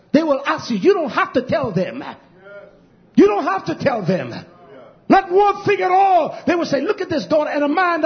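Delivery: high, loud shouted sermon; fiery pulpit shout, phrase by phrase; full-force preaching. Real, not acted. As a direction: thunder it out to the congregation.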